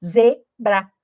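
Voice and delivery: "Zebra" dito devagar, sílaba por sílaba, boca bem aberta; sílabas marcadas. Shown as a demonstration. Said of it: "Quantas vezes eu abri minha boca?". ("Zebra" bem silabado)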